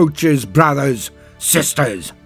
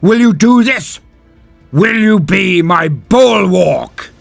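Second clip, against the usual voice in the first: loud, high challenge to the crowd; rallying cry; 7 semitones higher, 7 dB louder, slower than usual. Acted. Space Marine asks his troops to stand with him, rallying cry. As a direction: call out for a roaring answer, drawing out the end. Champion calls crowd to answer.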